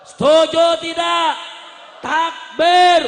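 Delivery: high, full-force drawn-out cry; rallying shout. Real, not acted. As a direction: bellow for the crowd to join in. Rallying the crowd, calls takbir.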